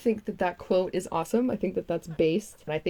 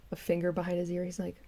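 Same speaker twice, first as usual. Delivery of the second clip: ordinary talk, nothing marked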